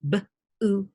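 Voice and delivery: b and oot said apart; teacher showing segmenting; segmented sounds. Shown as a demonstration. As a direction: split boot into b then oot. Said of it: "Segmenting is".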